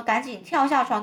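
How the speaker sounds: ordinary delivery, nothing marked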